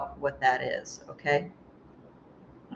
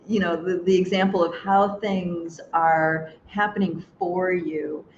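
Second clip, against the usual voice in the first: ordinary delivery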